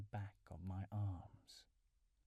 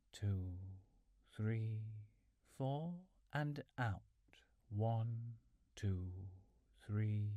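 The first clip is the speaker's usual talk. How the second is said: measured, drawn-out counting to pace breathing; steady meditation count with long, even gaps; slow breath counting; slower than usual. Real, not acted. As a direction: count out the breaths, leaving a pause after each number. Counts final round of breathing.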